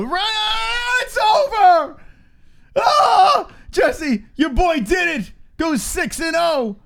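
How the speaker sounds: screaming celebration as the fighter wins; real ecstatic yell; excited shout. Real, not acted.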